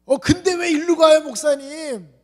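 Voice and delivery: put-on high, loud pushy young man; squeaky demanding youth. Young man questions pastor in car.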